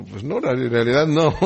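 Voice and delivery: conceding with a laugh in the voice; Balaam meekly gives in to the donkey, chuckling; sheepish admission. Acted. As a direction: admit it sheepishly, half-laughing.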